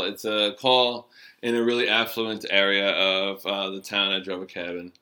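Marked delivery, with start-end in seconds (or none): none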